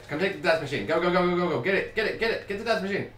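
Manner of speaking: high, loud rapid-fire commands; urgent shouting. Real, not acted. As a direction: yell to hurry your teammate along. Urges partner to grab power-up.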